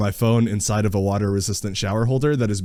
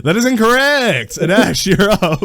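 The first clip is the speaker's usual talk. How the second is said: game show host; 9 semitones higher, 4 dB louder than usual. Acted. Host rules the answer wrong.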